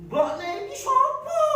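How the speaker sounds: high, slow, baffled voice; bewildered parent. Retold or imitated. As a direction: ask as if you can't believe your eyes. Parent puzzling over the newborn. (orang tua bingung bertanya, suara tinggi dan lambat)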